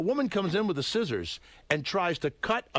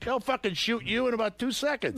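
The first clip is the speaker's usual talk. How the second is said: heated retort; 6 semitones higher than usual. Retold or imitated. Retelling his angry reply to Larry.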